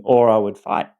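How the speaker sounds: ordinary delivery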